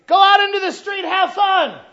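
high, loud shouted mock encouragement; mock permissive parent. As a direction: shout it cheerfully as a joke. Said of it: "We don't sit there and say".

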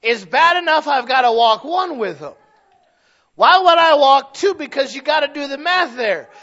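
loud, rising pulpit preaching; hammering each number slow and loud from the pulpit; preaching at full force. Acted. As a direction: preach it out to the congregation.

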